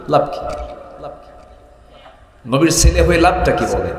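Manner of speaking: normal talking voice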